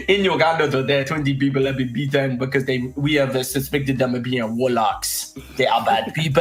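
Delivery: mock Ugandan-accented newsreader; Ugandan news report. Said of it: "looking at a fucking news report".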